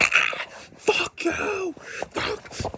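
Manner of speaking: very high, drawn-out mock rage; slow, high-pitched cartoon cursing; mock furious son. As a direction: imitate a son cursing wildly. Angry son getting worse.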